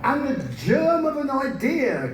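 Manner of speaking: excited. Acted. Idea strikes the teller.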